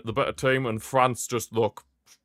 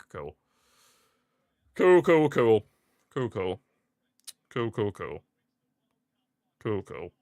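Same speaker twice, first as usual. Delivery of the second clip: sarcastic cool, fading. Real, not acted. Sarcastic reaction at hydration break.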